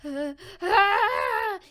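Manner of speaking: high, loud acted scream; acted choking scream. Deku attacked by slime monster, screaming. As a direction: cry out as if being grabbed.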